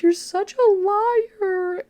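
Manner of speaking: high, slow, breaking accusation through tears; crying it out, voice cracking high; tearful accusation. Retold or imitated. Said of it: "I burst into tears".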